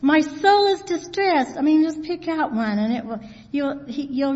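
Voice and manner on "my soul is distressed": higher mild cry of distress; crying out for help. Acted. Preacher voicing David's psalm, crying out for help. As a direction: call out in distress. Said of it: "full of David crying out for help".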